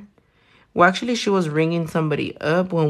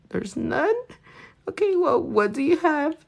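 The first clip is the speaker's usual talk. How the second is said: lightly voiced husband, pitched up and incredulous; a hint of the husband, pretending to stay polite; mock husband voice; 11 semitones higher, 4 dB quieter than usual. Husband asking the cashier what bread is left, mildly incredulous. Husband quoted at sandwich counter.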